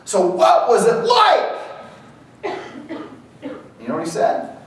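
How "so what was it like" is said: slow, high mock reporter question with a laugh. Reporter asking the climber, drawn-out high question. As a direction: stretch it out like an eager reporter. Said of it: "the reporter asks him this".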